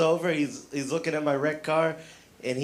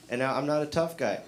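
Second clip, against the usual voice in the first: normal talking voice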